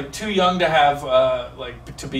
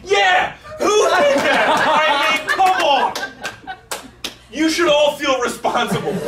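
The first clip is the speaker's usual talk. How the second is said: mock innocence; 9 semitones higher, slower than usual. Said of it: "And I was like".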